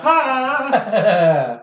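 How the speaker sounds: startled cry. Acted. Character runs to catch up.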